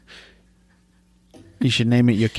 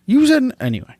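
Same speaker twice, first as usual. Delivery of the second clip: indignant; 12 semitones higher, 4 dB louder than usual. Real, not acted. Defensive protest.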